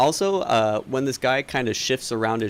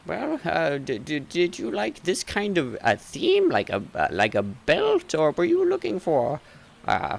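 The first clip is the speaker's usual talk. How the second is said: doddery shopkeeper, flustered; 9 semitones higher than usual. Acted. Shopkeeper asking a customer what theme they like, flustered. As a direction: stumble over your questions, pleading for guidance.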